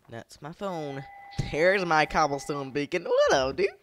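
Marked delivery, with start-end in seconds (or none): 3.0-3.7 high, loud, laughing taunts; squealing playful trash talk; gleeful taunting. Real, not acted. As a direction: crow at a cornered enemy. Taunting a mob in game.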